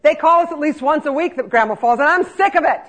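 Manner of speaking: angry granddaughter confronting the laundry aide in the grocery store; granddaughter getting mad. Retold or imitated. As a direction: tell her off as she hits her limit. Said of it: "her voice starts to go up".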